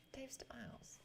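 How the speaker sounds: very soft whisper; whispered musing. Real, not acted. Streamer recalling a chat about co-host's name, whispered.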